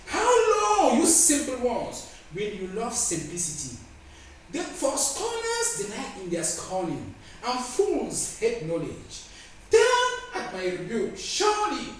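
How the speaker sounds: slow, high-pitched opening cries on key words; scripture declamation. Acted. Preacher reading Proverbs to the congregation, declaimed. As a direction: proclaim scripture with drawn-out force.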